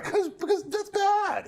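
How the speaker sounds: mock whine. Real, not acted. Mock complaint over the dice.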